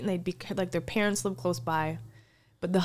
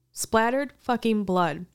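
ordinary delivery

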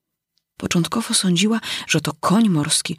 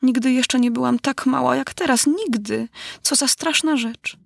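put-on higher-pitched, dismayed girl; distressed little heroine; 6 semitones higher than usual.

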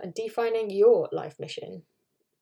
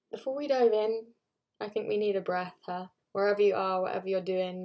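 calm grounding exercise. Acted. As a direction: guide a calm breath, gently. Leads a breathing moment.

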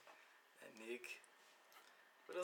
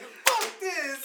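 a sharp, higher mock outburst; angry outburst; 10 semitones higher, 4 dB louder than usual. Kid fed up with a dare, a sharp mock outburst. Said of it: "he was like".

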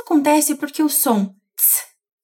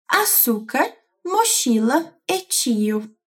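ordinary talk

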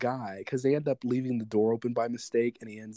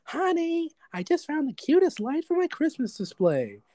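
much higher, gushing mom voice; imitating Cindy Lou's mom; 13 semitones higher than usual. Mom gushes to her husband about a light, high and gushing. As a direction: squeal it like a delighted mom. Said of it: "Cindy Lou's mom comes up and is like".

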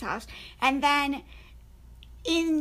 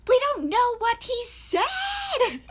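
high, loud and slow, in disbelief; exasperated outcry; 9 semitones higher, 4 dB louder, slower than usual. Retold or imitated. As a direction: throw your hands up, nobody understood him. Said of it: "And you're like".

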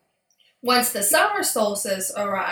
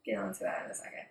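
whispered aside; 11 dB quieter, much faster than usual. Real, not acted.

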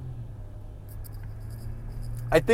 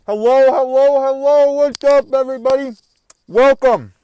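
excited greeting; 4 semitones higher, 7 dB louder than usual. Real, not acted. Energetic podcast intro greeting.

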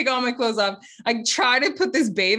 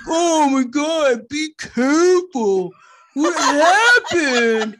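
higher, louder, slow fussing parent; worried dad; 6 semitones higher, 6 dB louder, much slower than usual. Retold or imitated. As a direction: mimic dad fretting over the fall. Dad reacting to her fall.

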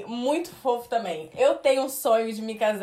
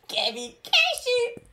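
imitating the jingle; 12 semitones higher than usual.

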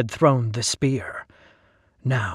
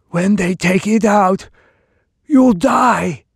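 much higher, louder dim-witted slave declaring; simpleton's voice; 7 semitones higher, 7 dB louder, slower than usual. Acted. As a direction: state the grim fact with simple cheer. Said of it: "he asked in a simpleton's voice".

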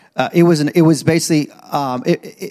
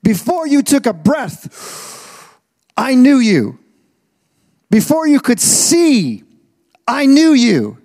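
rising proclamation; 6 semitones higher, slower than usual. Acted. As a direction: proclaim it like a promise from God. Preacher proclaiming God's words.

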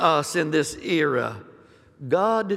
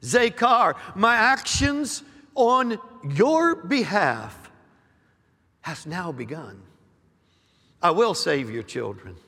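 prophetic declaring; 3 semitones higher than usual. Acted. Prophet to the church, stirring declaration. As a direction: proclaim each promise with force.

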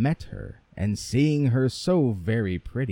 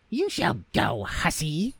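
scolding mother; 5 semitones higher than usual. Acted. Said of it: "said the mother".

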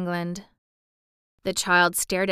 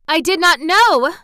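high, loud, frantic cry; distressed cry; 9 semitones higher, 11 dB louder than usual. Acted. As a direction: cry out in panic. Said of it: "the Mem Sahib cried".

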